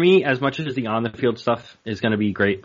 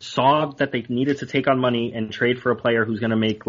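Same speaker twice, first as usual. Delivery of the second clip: ordinary talk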